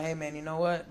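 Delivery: a lazy customer asking the store to deliver, soft and low; lazy mumble. Retold or imitated. Inviting store clerk home.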